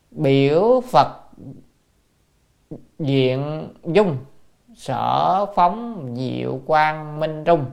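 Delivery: slow, drawn-out reading of classical text; scripture recitation. Real, not acted. Teacher reads old Buddhist commentary to students, slow recitation. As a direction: recite each word slowly and deliberately.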